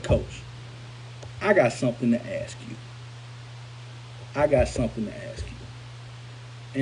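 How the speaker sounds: low, soft and weighty; grave, hesitant approach. Retold or imitated. Former player broaching an old question with his coach, grave.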